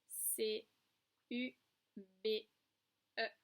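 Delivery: put-on letter by letter for young pupils; spelled out. Shown as a demonstration. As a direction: separate letter names with pauses. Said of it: "Voilà comment on écrit cube".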